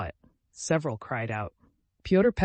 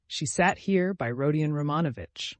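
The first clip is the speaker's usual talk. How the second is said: ordinary delivery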